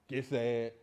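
ordinary talk, nothing marked

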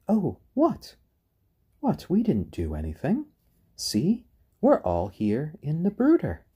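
high-pitched, mock-innocent protest from the guilty chicks. Chicks voiced playing innocent to the owner, in a high, mock-innocent protest. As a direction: squeak out an innocent denial, all wide-eyed. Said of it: "they all kind of looked up at me like".

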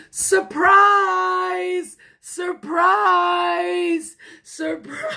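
high, loud, long-held shout; party shout. A narrator's reading. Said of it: "all the ladies shouted".

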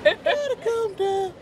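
high laughing words. Real, not acted. Host laughs while warning friend off the corner.

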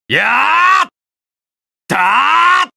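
high, rising attack yell; drawn-out kiai held at the top; long battle cry. Acted. Fighter charging in, long shouted attack cry.

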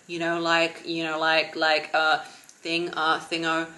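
mock a talker stuck on fillers; imitating unconfident filler talk; filler-word demo. Shown as a demonstration. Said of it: "That's not a confident vocabulary".

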